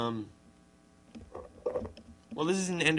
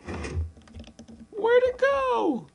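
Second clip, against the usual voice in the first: surprised; 4 dB louder, much slower than usual. Real, not acted. Surprised search for the egg.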